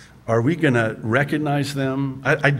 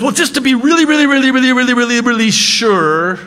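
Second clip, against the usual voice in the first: pleading over-insistence; 11 semitones higher, 6 dB louder, faster than usual. Retold or imitated. Gideon begging God for another sign, high and insistent. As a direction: beg for one more sign, voice climbing. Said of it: "And then Gideon says".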